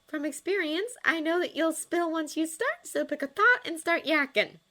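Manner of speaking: high, animated character voice; confident girlfriend nudging him to talk; Astrid teasing. A narrator's reading.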